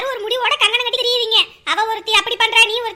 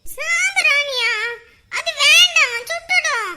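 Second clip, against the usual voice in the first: put-on child voice; 3 semitones higher, much slower than usual. Child protests the incense.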